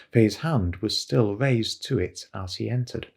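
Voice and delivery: ordinary delivery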